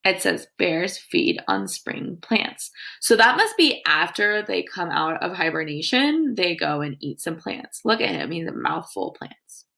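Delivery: usual speaking voice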